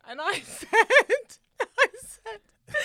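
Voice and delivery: loud, high, helpless laughter through the words; laughing. Real, not acted. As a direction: struggle to tell it for laughing. Cracking up while telling the story.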